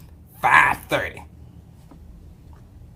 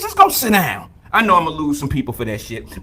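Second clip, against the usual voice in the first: ordinary delivery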